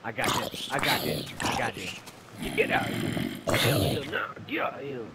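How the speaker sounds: put-on low, gruff old man yelling; lightly voiced grumpy old man. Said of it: "that's my old man voice".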